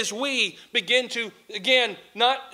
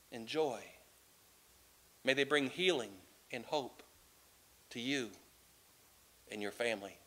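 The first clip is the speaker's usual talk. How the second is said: soft, low, gentle blessing with long pauses; tender king; 9 semitones lower, 12 dB quieter than usual. Acted. King blessing the poor mother as he gives grapes, soft and tender. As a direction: speak it like a loving gift, hushed.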